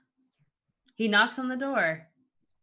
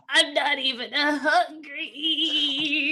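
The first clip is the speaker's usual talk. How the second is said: high, drawn-out tearful whine; hammed-up blubbering; crying; 6 semitones higher, much slower than usual. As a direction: wail it slowly like a sulking, sobbing child. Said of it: "eats it as he's just crying".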